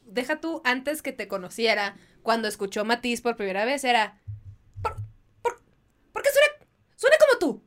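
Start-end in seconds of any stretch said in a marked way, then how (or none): 4.8-7.6 high, loud mock amazement; imitating a friend's excited disbelief; excited mimicry. Friend imitated asking why a singer sounds like her, high and amazed.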